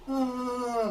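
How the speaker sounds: very high, drawn-out ghostly laugh; imitated kuntilanak cackle. Unseen kuntilanak laughs while passing by, imitated high ghost laugh. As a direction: mimic a ghost's shrill wail of laughter.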